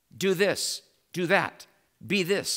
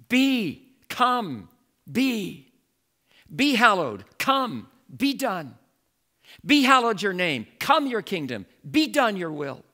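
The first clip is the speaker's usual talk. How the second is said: loud, higher commands with the verbs "Be", "come" stressed first; commanding; 3 semitones higher, 4 dB louder than usual. Shown as a demonstration. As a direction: give each verb as an order. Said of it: "We're commanding".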